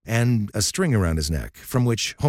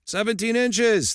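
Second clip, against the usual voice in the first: more confident answer; slower than usual. Acted. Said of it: "sounding more confident".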